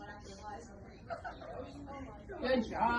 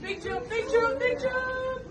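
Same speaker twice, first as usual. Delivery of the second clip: high excited cheer; 11 semitones higher, slower than usual. Real, not acted. Cheering child on.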